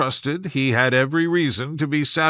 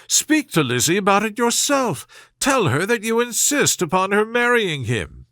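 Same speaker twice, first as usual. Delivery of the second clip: much higher, pleading and pushy; urgent fussy mother; 7 semitones higher than usual. A narrator's reading. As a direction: nag your husband to act. Mrs Bennet urging husband.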